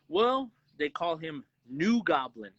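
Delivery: ordinary talk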